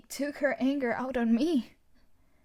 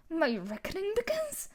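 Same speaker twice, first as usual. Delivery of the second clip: incredulous; 8 semitones higher than usual. Acted. Villain in disbelief.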